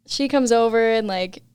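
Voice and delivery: usual speaking voice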